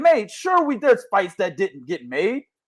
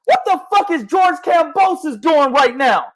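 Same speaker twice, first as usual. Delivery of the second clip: high, heated demand; exasperated rant; 6 semitones higher than usual. Real, not acted. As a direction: demand an answer from an absent boxer. Rants at boxer avoiding fights.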